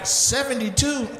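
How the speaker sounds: slow, high disbelieving question; repeating the age, stunned; stunned disbelief. Real, not acted. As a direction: stretch it out in shock.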